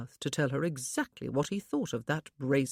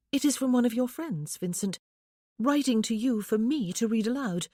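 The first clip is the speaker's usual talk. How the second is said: lightly voiced young wife, higher, wistful; a wife lifted a little higher, yearning; young woman's voice; 6 semitones higher, 4 dB louder than usual. A narrator's reading. Wife tells husband her longing, lightly higher voice.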